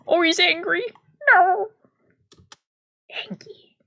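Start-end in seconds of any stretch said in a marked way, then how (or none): none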